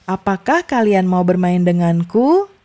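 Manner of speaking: denganku rises at the end of the question; rising question tune. Shown as a demonstration. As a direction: lift the voice on denganku. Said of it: "di akhirnya itu naik".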